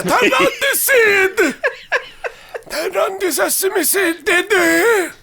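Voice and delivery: put-on very high, loud cackling old man; shrieking grandpa bossing Didi; old Yiddish grandpa. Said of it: "You're just doing the".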